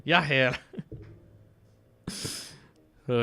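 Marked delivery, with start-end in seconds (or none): 0.0-0.6 short try at a German accent; attempted German accent. Shown as a demonstration. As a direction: practise the German accent briefly. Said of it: "trying to do a German one".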